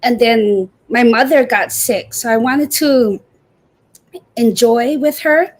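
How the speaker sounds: usual speaking voice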